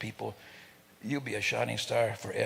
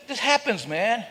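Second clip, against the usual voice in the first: usual speaking voice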